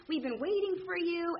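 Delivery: put-on sing-song welcome from excited teens; eager teen girls. Voicing the teenage girls crowding her.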